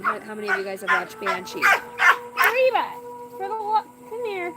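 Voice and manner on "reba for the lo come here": calling the dog. Real, not acted.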